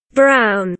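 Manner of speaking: ordinary talk, nothing marked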